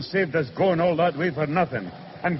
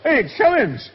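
indignant, higher-pitched disbelief; incredulous; 7 semitones higher, slower than usual. Acted. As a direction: query it as if robbed. Outraged at the taxi fare.

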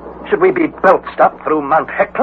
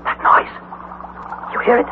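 frightened; 8 semitones higher, 3 dB quieter than usual. Acted. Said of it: "What is frightening you".

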